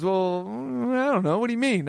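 imitated unsure reply, a bit soft; hemming and hawing, lightly imitated; hesitant worker. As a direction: play a worker caught without an answer. Voicing the clueless popcorn worker.

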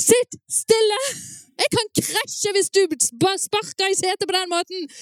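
high-pitched parental yell; loud scolding order. Acted. Parent to child kicking the car seat, loud scolding. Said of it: "en veldig høy beskjed".

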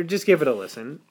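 ordinary delivery

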